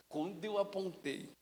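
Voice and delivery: normal talking voice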